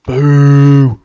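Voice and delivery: booming boo into the mic; loud boo. Real, not acted. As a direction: boo as loud as you can. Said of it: "Oh, that was loud".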